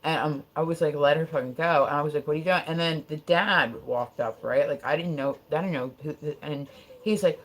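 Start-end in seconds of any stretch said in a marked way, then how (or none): none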